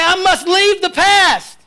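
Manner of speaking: slow, loud, high shout of I must live; shouted call. Shown as a demonstration. Preacher rousing the congregation, slow shout. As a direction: cry it out slowly for all to repeat.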